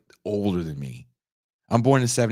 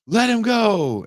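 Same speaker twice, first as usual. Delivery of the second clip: high loud mock command; armed girlfriend; 6 dB louder, slower than usual. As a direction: squeal it out like a woman pointing a gun. Woman with a gun in the retold scene.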